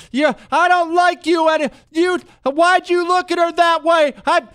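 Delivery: high, loud shrieking accusation; drunk girlfriend yelling. Retold or imitated. Girlfriend chewing out her man in a restaurant, loud high yell. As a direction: make a scene at the table. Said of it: "she's getting loud".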